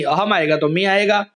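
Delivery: ordinary delivery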